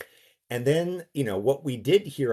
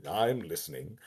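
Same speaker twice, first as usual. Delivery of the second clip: put-on cultivated, somewhat New England accent; understated, softer, cultured radio-host line; Frasier; 3 semitones lower, 4 dB quieter than usual. Actor quoting Frasier to show the accent, cultivated New England. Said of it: "I was kind of doing a Frasier accent".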